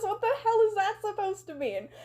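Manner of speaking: high-pitched affronted retort; acted indignation. Connie answering the caller's accusation, playfully indignant. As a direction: demand an answer, offended.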